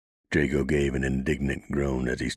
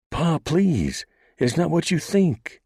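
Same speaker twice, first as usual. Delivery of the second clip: very high, loud desperate plea; pleading cry; 5 dB louder, slower than usual. A narrator's reading. Daughter crying out pleading with her father. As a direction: beg him to stop. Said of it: "Banshee cried".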